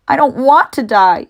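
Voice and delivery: high, loud moaning plea. Acted. Wilbur moans to Charlotte after bursting into tears. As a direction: moan it out in tears. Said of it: "he moaned".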